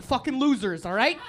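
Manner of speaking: ordinary delivery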